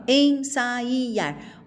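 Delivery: "ensaiar" as en-sa-I-ar; split vowels. Shown as a demonstration. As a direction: make the i its own syllable. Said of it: "Eu não falo".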